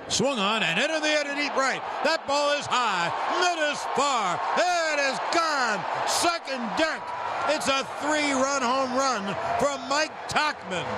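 rising play-by-play to a shout; home run call. Acted. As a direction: build the call to a roaring finish.

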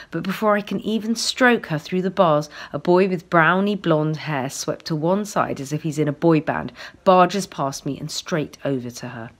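usual speaking voice

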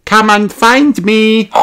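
put-on high, teasing little girl call; Peppa calling out. A narrator's reading. Peppa calling George from inside the box, high and teasing. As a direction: tease him from the hiding place. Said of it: "said Peppa".

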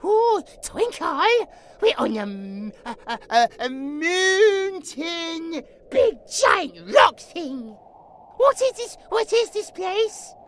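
put-on loud, stretched-out childlike wonder; childlike amazement. J J showing Twinkle a strange new place, amazed childlike voice. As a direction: stammer and draw out words in amazement.

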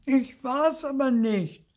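very high, slow, loud denial; high-pitched drawn-out wail of denial; Zipfel. A narrator's reading. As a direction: protest your innocence as a small ghost. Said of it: "sagt Zipfel".

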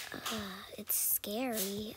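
slow scared whisper. Real, not acted. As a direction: breathe it out fearfully. Scared in dark level.

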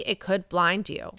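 usual speaking voice

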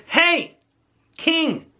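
high call across the valley. Retold or imitated. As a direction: call up to the camp from afar. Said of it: "he shouts down and he says".

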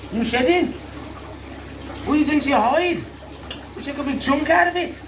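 high, cooing pet voice. Real, not acted.

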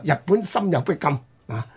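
ordinary talk, nothing marked

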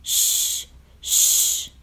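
two drawn-out shushes; begging shush. A narrator's reading. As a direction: hush them pleadingly. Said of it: "begged Grump".

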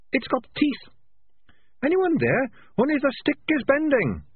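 high, small, oddly calm rat; a tiny chirpy voice, unbothered; little rat voice. A narrator's reading. Sardines from the trap.

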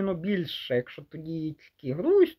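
ordinary delivery

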